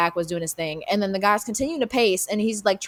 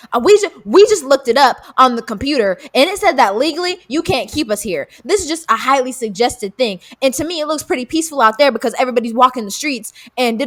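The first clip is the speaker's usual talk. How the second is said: louder, a bit higher whiny complaint; mock indignant customer; 4 semitones higher, 7 dB louder than usual. Imitating the customer who claims he is held hostage, louder mock whine. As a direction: ham up the entitled complainer.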